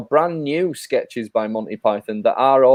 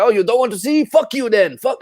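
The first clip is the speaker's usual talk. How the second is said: higher, mock-huffy waiter swearing; offended waiter; 9 semitones higher than usual. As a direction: toss it off like an insulted waiter. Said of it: "he's like".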